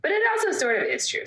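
high, quick teasing reveal; teasing cohost with a twist, high and quick; playful twist. Real, not acted. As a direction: flip it up high and fast.

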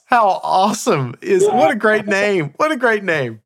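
thrilled by a clever name; host delighted by the bus name, excited and high. Real, not acted.

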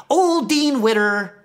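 grandma drilling the parrot with a new phrase; grandma coaching the parrot. Retold or imitated. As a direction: coax it slowly like training a bird. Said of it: "she would say".

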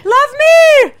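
very high, loud title call; grand announcement. Acted. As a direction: belt the title out like a showstopper. Repeating book title grandly.

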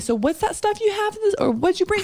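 high, friendly dad voice; imitating dad. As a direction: perk up like an interested parent. Dad chatting with daughter, retold.

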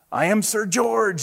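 squeaked, drawn-out proclamation; squeaky little knight. Acted. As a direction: announce yourself as a timid storybook knight. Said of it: "squeaked a thin, shiny knight".

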